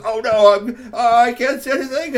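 high, loud cry of panic; panicked driver. Acted. Tony blinded at the wheel, high loud panic. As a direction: blurt it out in a fright.

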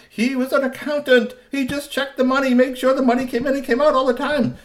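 high pleading blame-shifter; high whiny villain. Acted.

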